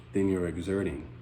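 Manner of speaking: ordinary delivery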